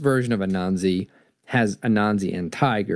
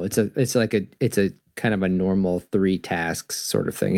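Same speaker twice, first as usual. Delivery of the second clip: ordinary delivery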